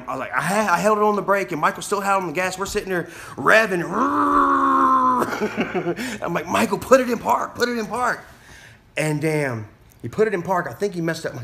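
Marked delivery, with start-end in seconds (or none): 3.9-6.2 high, sustained mock revving, then a chuckle; engine revving noise. Storyteller mimicking a car revving in place, high and drawn out. 6.5-8.2 high, raised-voice retold order; urgent command. Teacher ordering learner to stop the car, high and urgent.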